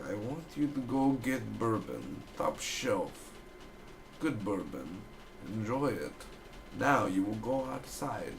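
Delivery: lightly voiced hypnotist, slow and soft; a hint of a calm hypnotist lulling a worker. Hypnotist giving soft slow commands.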